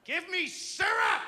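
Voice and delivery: put-on high, slow child yell; furious toddler demand. Angry three-year-old demanding syrup from dad, yelling. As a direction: screech it like a tiny tyrant. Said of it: "she's a little ball of anger".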